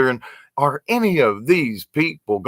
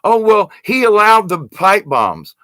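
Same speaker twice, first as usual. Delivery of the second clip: mock whine; 6 semitones higher, 4 dB louder than usual. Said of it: "For what?".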